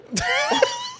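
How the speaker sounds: sudden squealing laugh; real high-pitched laugh; high burst of laughter. Real, not acted. Laughing at the story.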